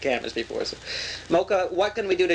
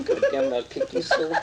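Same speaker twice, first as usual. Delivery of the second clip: laughing curse. Acted. Frustrated with laughing medium.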